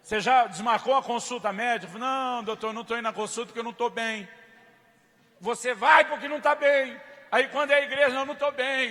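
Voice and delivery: loud, high, forceful preaching; fiery preaching. Acted. Pastor to congregation, loud fiery preaching.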